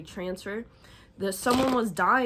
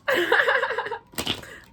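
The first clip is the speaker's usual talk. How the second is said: high loud laugh; 7 dB louder than usual. Real, not acted. Loud laugh after welcome.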